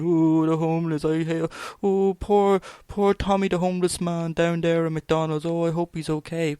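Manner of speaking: high, syrupy mock concern; mocking fake pity. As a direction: parody the cozy Facebook poster's pity.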